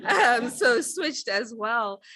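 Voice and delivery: chuckling through the words; laughing. Real, not acted. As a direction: laugh it off, amused at herself. Laughing at own career switch.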